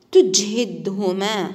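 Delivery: تجهدهما stretched very slowly for pupils to copy; slow model reading. Shown as a demonstration. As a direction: spell out تجهدهما slowly for the class. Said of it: "سوف نقوم بقراءتها مرة أخرى". (نطق بطيء جدا لتجهدهما)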